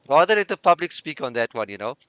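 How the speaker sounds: ordinary delivery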